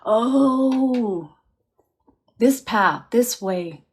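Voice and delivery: as if spotting the way forward; eureka moment. Retold or imitated. Medium voicing the dad finding his path, delighted discovery.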